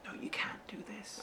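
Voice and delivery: very soft mock inner voice; hushed playful contradiction; whispered discouragement. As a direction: whisper the doubt back, teasing. Whispers back to the imagined actor.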